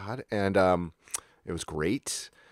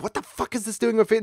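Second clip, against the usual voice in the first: higher, fast freaked-out squeal; panicked mock question; 13 semitones higher, faster than usual. Fish nibbling his feet while snorkeling, panicked. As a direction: rattle it off in a panic. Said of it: "you're like".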